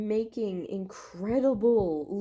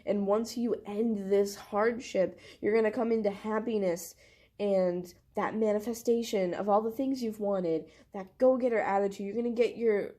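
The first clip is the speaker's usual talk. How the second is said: usual speaking voice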